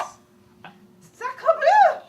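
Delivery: high, loud French outburst; shocked French exclamation. Acted. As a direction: recoil in horror, French. French visitor shocked at art.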